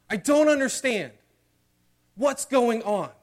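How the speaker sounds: high, loud pleading outcry; anguished cry. Acted. Believer crying out to God in distress, loud and high.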